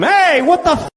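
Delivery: high-pitched outraged yelping; angry yelp. Acted. Comic shocked he's been hit, high outraged yelp. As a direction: yelp in sudden shocked anger. Comedian acting out getting hit.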